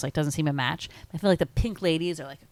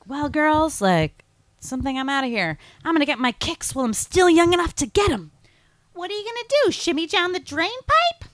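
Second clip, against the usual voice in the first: high, loud movie-character impression; hammed-up imitation of a movie line; Rizzo; 11 semitones higher, 6 dB louder than usual. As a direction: act out the big exit speech, high and loud. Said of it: "And she's like".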